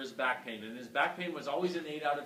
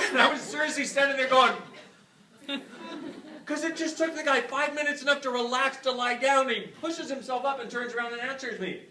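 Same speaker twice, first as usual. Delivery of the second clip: amazed retelling; 8 semitones higher, 7 dB louder than usual. Real, not acted.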